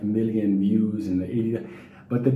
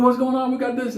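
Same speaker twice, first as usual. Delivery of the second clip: high, excited hello from a car window; eager fan greeting; 12 semitones higher than usual. Retold or imitated. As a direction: call out like a buddy. Men in car greeting him.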